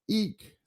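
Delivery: mock scream. Woman sees ghost again.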